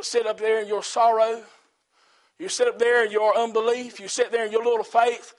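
normal talking voice

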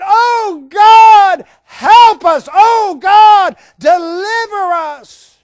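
high, very loud desperate plea; crying out. Shown as a demonstration. Enslaved Israelites begging God for deliverance, crying out. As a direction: shriek it up to heaven. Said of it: "to actually shriek out in a company".

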